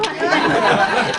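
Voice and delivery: breathy laugh; real laugh. Real, not acted. Host laughing at the mistake, real laugh. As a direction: crack up.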